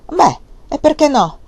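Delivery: loud angry comeback; angry retort. A narrator's reading. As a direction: fling the question back in anger. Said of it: "replicò con rabbia la donna".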